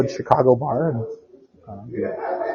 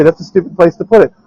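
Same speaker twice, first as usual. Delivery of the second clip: retold yelling; 6 semitones higher, 6 dB louder, faster than usual. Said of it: "she'd yell at them and say".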